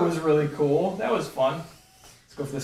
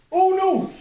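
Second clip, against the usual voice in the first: dismayed cry; 13 semitones higher, 5 dB louder than usual. Real, not acted. Drone crashing.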